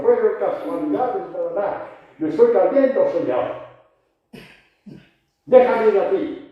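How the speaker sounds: panicked prayer, high and loud; pleading, overwhelmed Peter; desperate Peter. Acted. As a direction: cry out to God as you sink, rising in urgency. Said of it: "nos toca decir como Pedro".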